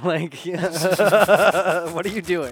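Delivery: laughing through the words, slow; cracking up mid-question. Real, not acted. Cohost cracking up at the silly accent, laughing.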